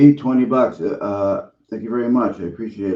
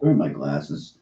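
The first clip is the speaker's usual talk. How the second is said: ordinary talk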